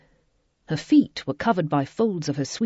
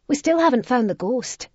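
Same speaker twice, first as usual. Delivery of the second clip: put-on 1 girl's voice; 8 semitones higher, 4 dB louder than usual. A narrator's reading. Gavina, a girl, voiced.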